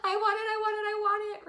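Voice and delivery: high, loud giddy whine; greedy whine. Retold or imitated. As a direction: whine for the money like a kid. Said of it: "I'm thinking".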